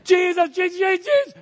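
high, loud, pleading cries of his name; desperate calling out. Acted. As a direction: call out to Jesus as if your life depends on it. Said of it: "What are they doing?".

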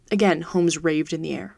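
usual speaking voice

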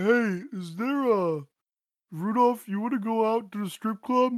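high, drawn-out character voice; put-on high voice, loosely sketched; Talon. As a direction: pitch it way up and stretch the invitation. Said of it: "I think that's Talon".

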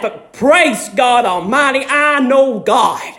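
high, ringing call and response; preaching refrain. Acted. Preacher testifying to the church, ringing refrain. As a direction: build each praise God line for the crowd.

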